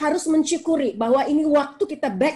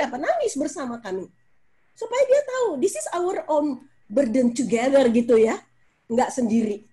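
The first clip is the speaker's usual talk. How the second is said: ordinary talk, nothing marked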